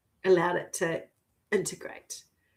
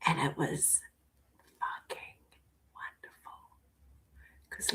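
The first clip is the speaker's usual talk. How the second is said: hushed, awed whisper; softly whispered delight; breathless whisper; 4 semitones lower, 14 dB quieter than usual. Real, not acted. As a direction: whisper it like a precious secret. Savouring a happy memory.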